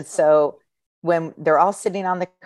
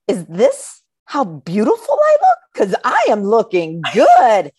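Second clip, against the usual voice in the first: playful vanity; 5 semitones higher, 5 dB louder than usual. Retold or imitated. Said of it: "And I'm like".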